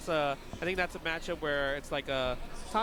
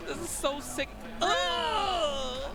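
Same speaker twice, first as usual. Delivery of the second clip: high excited yelp; excited cry; 11 semitones higher, much slower than usual. Real, not acted. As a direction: react like you can't believe it. Reacting to replay.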